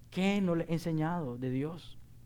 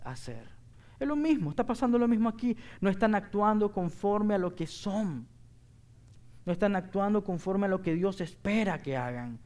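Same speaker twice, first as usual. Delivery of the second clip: fervent preaching; 3 semitones higher than usual. Acted. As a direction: preach it full force to the congregation. Pastor preaching to congregation.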